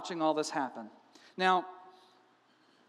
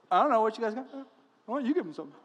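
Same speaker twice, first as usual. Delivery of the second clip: holding back a smile; 3 semitones higher, much faster than usual. Retold or imitated. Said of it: "holding back a smile".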